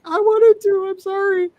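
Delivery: very high, pleading squeal; hammed-up plea, voice shooting way up; mock wailing apology. Streamer apologizing to viewers who wanted the horde, mock wailing. Self-quoted apology to disappointed chat.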